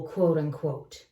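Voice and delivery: ordinary delivery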